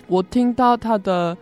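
ordinary delivery

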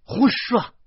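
high, loud snap; angry rebuke. A narrator's reading. Aunt angrily cuts off the joking son. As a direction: bark it out in anger. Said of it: "大爷发怒了".

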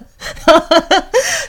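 high, loud burst of laughter; helpless laughter out loud. Real, not acted. (放声大笑)

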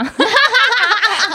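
loud giggle. Real, not acted. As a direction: burst of loud laughter. Giggle after asking if he's cute. (大笑)

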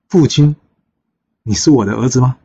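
stunned, can't believe it's his son; shocked surprise. Acted. Father stunned to meet his lost son, rising in disbelief.

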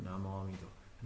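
low, very soft Buddha-name chant; soft low devotional murmur; gentle recitation. Shown as a demonstration. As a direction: lightly hold the name, hushed. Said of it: "轻轻的提着".

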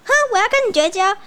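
put-on high, fast huffy child; 赌气绝交. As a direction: snap it out like a sulking kid. 吵架绝交的孩子. (气呼呼地说要绝交)

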